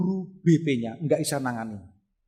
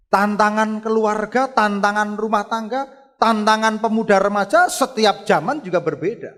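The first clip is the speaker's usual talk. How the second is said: loud, raised sermon voice; forceful preacher's list; emphatic preaching; 7 semitones higher, 9 dB louder than usual. Real, not acted. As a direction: drive each item home to the congregation.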